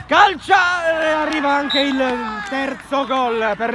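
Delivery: slow, rising goal shout. Real, not acted. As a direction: stretch it out as it goes in. Third goal called live. (urlo del gol)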